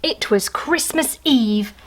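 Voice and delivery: usual speaking voice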